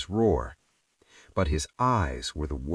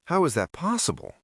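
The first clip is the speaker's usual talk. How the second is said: much higher, baffled plea; astonished question; 10 semitones higher, faster than usual. A narrator's reading. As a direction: reach up in disbelief. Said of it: "Evelyn asked".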